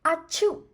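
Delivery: a big high sneeze, Achoo; loud sneeze. Acted. Monkey sneezes in story.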